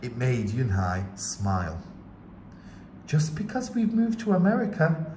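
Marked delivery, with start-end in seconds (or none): 3.1-5.1 higher, gentle mom voice; mother voice. A narrator's reading. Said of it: "her mother said".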